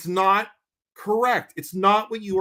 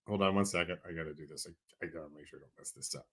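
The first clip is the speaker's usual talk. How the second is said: low, quiet aside to himself, away from the mic; muttered aside; 11 semitones lower, 14 dB quieter, much faster than usual. Real, not acted. Host mutters to himself while checking the fundraiser name. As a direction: mutter while looking something up.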